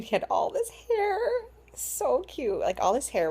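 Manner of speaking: high, crying while speaking; real tears of joy breaking through; happy tears. Real, not acted. New mother describes her baby's hair, crying with joy.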